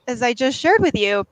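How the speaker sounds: usual speaking voice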